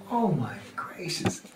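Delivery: slow whispered disbelief; stunned whisper. Retold or imitated. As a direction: breathe it out, barely voiced. Stunned at her birthday song.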